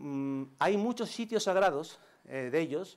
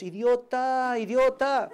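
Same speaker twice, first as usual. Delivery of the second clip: put-on high, sing-song baby talk; cooing at a baby; 6 semitones higher, 4 dB louder, much slower than usual. Japanese visitor coos at your baby, sing-song. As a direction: coo it sweetly to the baby. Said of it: "se pone a deciros".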